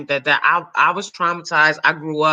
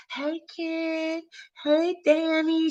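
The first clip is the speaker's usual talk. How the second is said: much higher, soft sweet greeting; mock Monique greeting; 14 semitones higher, 7 dB quieter, much slower than usual. Monique greeting the speaker after the show, sweet and high. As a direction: coo it like a kind star. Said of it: "she was like".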